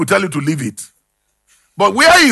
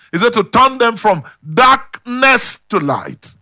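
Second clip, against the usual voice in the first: ordinary talk, nothing marked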